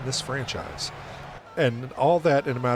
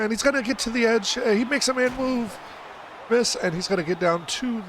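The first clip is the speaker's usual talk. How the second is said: rising excitement, much higher pitch; excited; 10 semitones higher than usual. Real, not acted. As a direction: call the run with mounting excitement. Exciting run in play-by-play.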